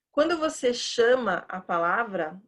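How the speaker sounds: ordinary delivery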